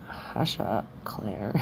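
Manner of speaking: breathy teasing whisper, voice dropped low; playful whisper. Real, not acted. Teasing Claire to hush, playful whisper.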